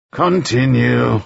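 slow, weary go-ahead; tired judge grudgingly lets Broom go on; resigned. Acted. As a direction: give in with a sigh in the voice. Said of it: "with a tone of resignation".

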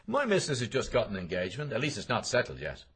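a chatty acquaintance bragging about his missus; voiced McCoy. A narrator's reading. McCoy bragging to Bloom about his wife, loud and high.

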